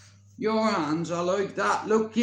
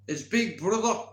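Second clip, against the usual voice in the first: ordinary delivery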